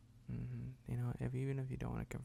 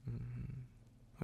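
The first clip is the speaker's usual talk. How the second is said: ordinary delivery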